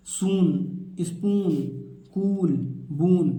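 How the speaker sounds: "सून, स्पून, कूल, बून" each with a held oo; long oo vowel. Shown as a demonstration. Teacher listing double-o words, long-vowel "सून, स्पून, कूल, बून". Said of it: "बड़े ऊ की मात्रा हो जाएगा".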